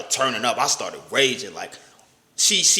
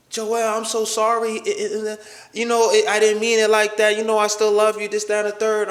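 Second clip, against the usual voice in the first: a light imitation of the girlfriend, higher and pleading; mildly mimicking her higher, sorry pleading; 7 semitones higher than usual. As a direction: voice the apologetic girlfriend, pitched higher. Girlfriend apologizing on the phone.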